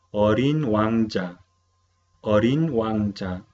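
Orin wangja said slowly and clearly; teacher's model pronunciation. Shown as a demonstration. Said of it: "vamos a pronunciar las palabras nuevas". (profesora modelando orin wangja despacio)

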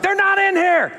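high-pitched exasperated outburst; exasperated protest. Retold or imitated. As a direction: protest it high, searching in vain. Retold exasperated search of the tub.

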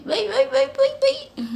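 high cutesy farewell; high sing-song bye. Real, not acted. Cooing goodbye.

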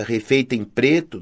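usual speaking voice

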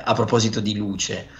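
ordinary talk

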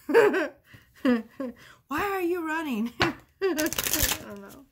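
giggly high question through laughter; laughing, high. Real, not acted. Laughing at her own joke to the viewer, high giggly question.

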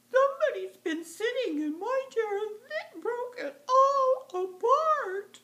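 high, squeaky wailing little bear; small wee bear. Acted. Wee bear finds chair broken, high tearful wail. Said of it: "Small little wee bear said".

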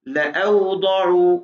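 लअउदउ said very slowly, alif not read; correct reading. Shown as a demonstration. Teacher demonstrates the correct reading to the student, slowly. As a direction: stretch लअउदउ slowly but keep the ला short. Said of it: "तो अलिफ नहीं पढ़ना".

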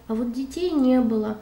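ordinary delivery, nothing marked

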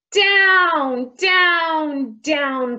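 each word starts high and drops; plunging down. A narrator's reading. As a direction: sound out a kite plummeting. Kite plunging down in story.

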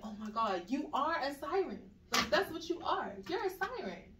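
high, emphatic retold exclamation; imitated excited revelation. Woman running out to tell her she's a siren, imitated excitement. She ran out to announce discovery.

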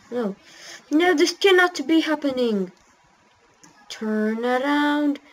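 normal talking voice

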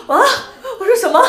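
high, loud startled question; shocked question. Real, not acted. Vlogger stunned by the man's Cantonese. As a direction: blurt out in disbelief.

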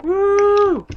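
high whooping cheer; excited whoop. Real, not acted. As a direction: let out a high celebratory woo. Cheering in game.

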